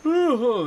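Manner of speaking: loud, high yawning ooh. Real, not acted. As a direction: let out a big yawn mid-sentence. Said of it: "the yawns, I'm sorry".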